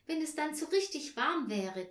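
dreaming aloud. A narrator's reading. Tina imagines the warm attic, dreaming aloud. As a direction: spin out the daydream. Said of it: "träumte Tina weiter".

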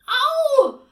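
sudden high, very loud yelp; pained scream. A narrator's reading. Child pinched on the arm by her aunt, screaming in pain. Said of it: "Anne schreit".

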